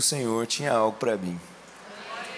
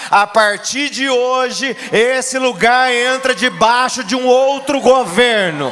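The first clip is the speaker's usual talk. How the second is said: very slow, much louder and higher, shouting decree; royal proclamation; 10 semitones higher, 9 dB louder, much slower than usual. Acted. Jesus proclaiming in hell, slow thunderous decree. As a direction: proclaim it over hell like a king's herald. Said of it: "Ele entrou para proclamar".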